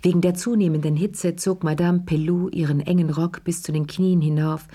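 ordinary delivery

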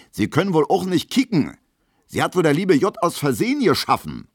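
viel höher und lauter, spöttisch geschrien; höhnisches Schreien. A narrator's reading. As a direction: Verachtung hinausbrüllen. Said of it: "schreit Gustav Schmelow". (Schmelow verhöhnt Emil vor allen laut schreiend)

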